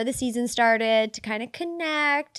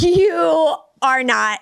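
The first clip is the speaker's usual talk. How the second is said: louder, higher, drawn-out contradiction; exasperated yell, stretched out; retold yelling; 5 semitones higher, 5 dB louder, much slower than usual. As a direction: fire back slowly and loudly, retelling the row. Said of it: "I'm yelling at him".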